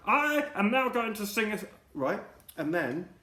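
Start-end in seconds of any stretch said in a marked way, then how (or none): 0.1-1.6 high and loud, like into a mic; loud announcing. Retold or imitated.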